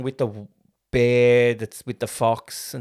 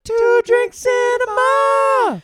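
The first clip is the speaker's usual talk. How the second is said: silly high drawn-out voice; 9 dB louder, much slower than usual. Real, not acted. Tipsy drawn-out aside.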